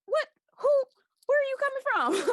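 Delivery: very high, loud startled squeal; retold shock. Freaked-out kid meeting her idol on a park bench, squealing. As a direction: shriek it like you can't believe who just sat down. Shocked to meet [name].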